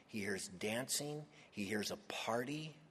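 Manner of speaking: hushed whisper building suspense. Acted. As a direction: lean in and whisper it.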